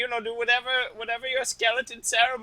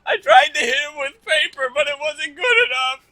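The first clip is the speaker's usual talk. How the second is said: loud, high hammed-up wail; fake-cry it out; mock sobbing; 8 semitones higher, 10 dB louder than usual. Spad grieving Kevin to the skeletons, hammed-up loud crying. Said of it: "with tears rolling down his face, he goes".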